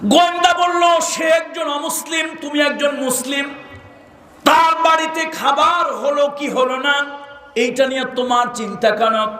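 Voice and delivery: high, ringing sermon narration; impassioned preaching. Acted. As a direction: challenge it in a high preacher's chant. Preaches in raised chant.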